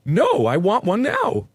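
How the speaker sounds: high, drawn-out foot-stomping demand; petulant demand. A narrator's reading. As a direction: demand it like a stroppy toddler, slowly. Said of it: "she demands, stomping her foot again".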